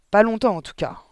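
sharp barked comeback; yapping retort. A narrator's reading. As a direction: yap it back like a cross headmistress. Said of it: "jappa mademoiselle Le Gourdin".

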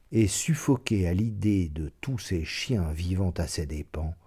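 usual speaking voice

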